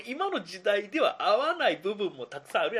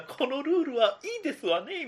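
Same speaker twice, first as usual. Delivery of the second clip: lightly voiced refined rich lady; a hint of a haughty rich girl; haughty rich girl japanese; 7 semitones higher than usual. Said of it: "みたいな感じでやるのも".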